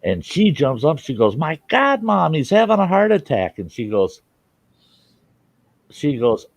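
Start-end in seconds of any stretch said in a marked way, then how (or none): 1.3-3.6 high, alarmed cry to her mother; panicked daughter. Retold or imitated. Daughter calls to her mother in panic over the heart attack. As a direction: sound the alarm, voice jumping up.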